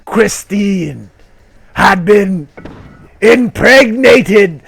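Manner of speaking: slow, loud, high booming reveal; hammed-up climactic shout; dramatic reveal. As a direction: drag out the twist like a trailer.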